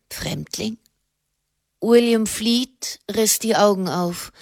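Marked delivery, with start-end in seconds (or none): 0.1-0.8 low, hushed echo; quietly taken aback. A narrator's reading. Hermit queries the word stranger, quietly taken aback. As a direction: repeat it softly in disbelief.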